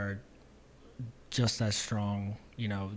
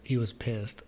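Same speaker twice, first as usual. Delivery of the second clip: ordinary delivery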